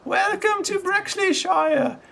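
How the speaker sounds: put-on very high, bright-eyed small boy; high child voice of a small boy with a crutch; little boy voice. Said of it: "He goes".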